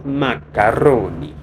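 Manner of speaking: loud, drawn-out Italian flourish; hammed-up Italian accent. Half-Italian cook showing off the dish, hammed-up Italian accent. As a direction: belt it out slowly with an Italian accent.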